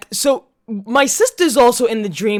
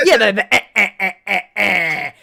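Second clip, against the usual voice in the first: imitated laugh; 2 semitones lower than usual. Mocking Jack's laugh on the podcast, a put-on chuckle. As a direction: mimic the friend's chuckle. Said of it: "the fucking laugh".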